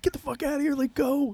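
squeaky high-pitched get-out yell; shrill-high imitation of shouting her out; cartoonishly high yelled order; high mock yell. Friends yelling at intruder.

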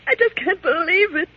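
high, tearful cry; distraught woman near tears; breaking into tears. Acted. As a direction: let the voice crack with sobs. Learns fiancé may be Nazi.

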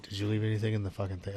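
low, very soft and fast under the breath; muttered pit boss question. Retold or imitated. The pit boss asking the drunk friend, a fast low mutter. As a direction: rattle it off quietly, annoyed.

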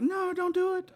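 high-pitched mock plea; high pleading. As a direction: squeak out a pleading no. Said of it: "Everybody in the room's like".

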